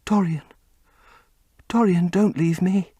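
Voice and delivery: usual speaking voice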